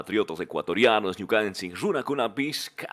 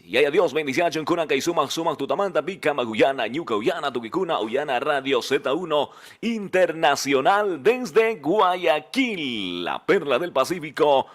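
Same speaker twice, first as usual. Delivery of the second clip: radio announcer; 4 semitones higher, 3 dB louder than usual. Acted. DJ's station ID over music.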